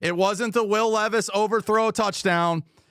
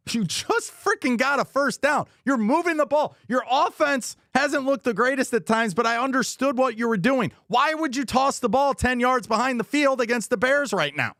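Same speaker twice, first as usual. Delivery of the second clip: higher-pitched, incredulous scolding; exasperated rant; 7 semitones higher than usual. Real, not acted. Fan venting at coach Callahan, higher and exasperated. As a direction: let the frustration climb at the coach.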